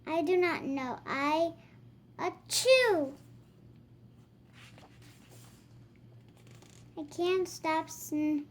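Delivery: put-on lively read-aloud voice for kids; reading to children. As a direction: act out the book for little ones. Reading picture book dialogue aloud.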